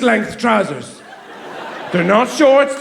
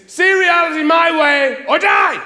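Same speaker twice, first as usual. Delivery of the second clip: high, loud, slow mock rant; hammed-up shouted ultimatum; mock furious shout; 8 semitones higher, 4 dB louder, slower than usual. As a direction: bellow it like a tyrant partner. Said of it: "what that means is".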